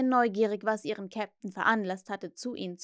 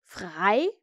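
surprised; 3 semitones lower, 5 dB louder than usual. Acted. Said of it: "die überraschte Antwort".

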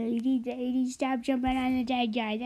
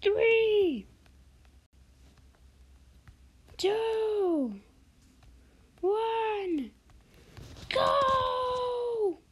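countdown shout; 12 semitones higher, much slower than usual. Real, not acted.

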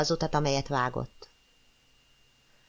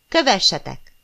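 rallying shout; 3 semitones higher, 5 dB louder than usual. A narrator's reading. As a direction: rouse the soldiers forward. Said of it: "kiáltotta".

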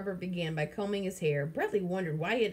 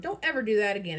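louder scolding mother; exclaimed sharply; 2 semitones higher, 5 dB louder than usual. Acted. Mother scolding her son after swerving, loud and sharp. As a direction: snap at him after the swerve. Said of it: "exclaimed his mother".

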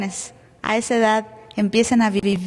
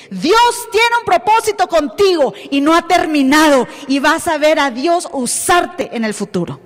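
loud, higher, slowing prophetic proclamation; bold declaration; 4 semitones higher, 5 dB louder than usual. Retold or imitated. Preacher retelling her word to the divorced pastor, bold loud proclamation. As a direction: declare it over him with full conviction.

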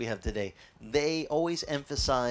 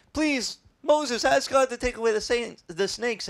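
begging; 8 semitones higher than usual. Retold or imitated. Israelites begging Moses to remove the snakes, higher plea. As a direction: whine out a desperate please. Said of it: "They said".

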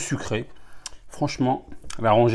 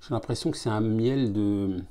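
glass held at lips; sipping between words; talking into a glass; 6 dB quieter than usual. Real, not acted. Seen 0: Glass pressed to lips throughout the words, sipping while talking.

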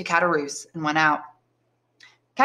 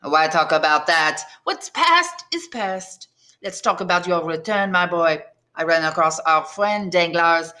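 put-on chummy, thick Marseille French accent; change the subject in heavy Marseille accent. Tailor asks about Edmond's return, Marseille accent. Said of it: "in a heavy Marseille accent".

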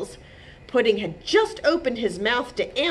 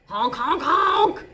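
honking goose, rising to a loud last honk; grouchy goose; 8 semitones higher, 4 dB louder, slower than usual. A narrator's reading. Geezer answering the chipmunk, grouchy honks. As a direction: honk like a mildly grumpy goose. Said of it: "who sounded grouchy".

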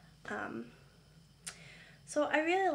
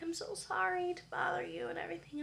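apologetic caller; 7 semitones higher, 3 dB quieter than usual. Retold or imitated.

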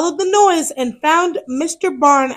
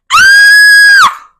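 much higher, loud scared cry; startled scream; 10 dB louder than usual. Acted. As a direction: yelp in fright. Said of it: "he cried".

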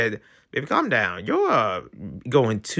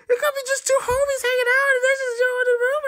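high, fast put-on voice; squeaky defensive character voice; voicing a flustered webtoon character; faster than usual.

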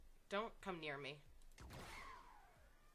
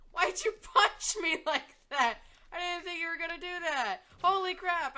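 laughing disbelief; 7 semitones higher, 6 dB louder than usual. Real, not acted. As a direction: laugh through the shock. Laughing shock after a punch.

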